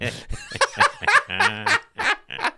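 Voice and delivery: shrieking giggle up high; cracking up at own awkwardness; high squealing laugh. Real, not acted.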